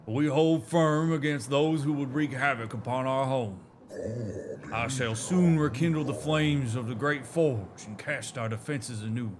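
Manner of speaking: light Southern drawl for a storytelling elder; a hint of a Southern drawl, proud and nostalgic. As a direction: read it like a Southern elder recalling old glories. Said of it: "it's fun doing that voice".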